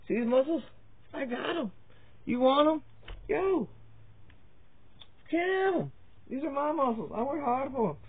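a hint of a cocky gym bro teasing the camera; muscle show-off. Showing off his muscles on camera.

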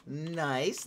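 usual speaking voice

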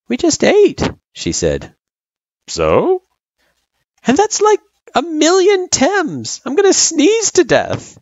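put-on high, fretful little girl; a nervous kid grumbling. Frisk complains about the monsters.

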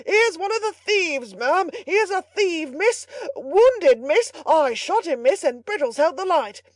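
high, loud calling up the stairs; greatly excited bawling. A narrator's reading. Butler reporting a captured thief to his mistress, excited shouting. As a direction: bawl each piece of news upward in triumph. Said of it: "calling in a state of great excitement".

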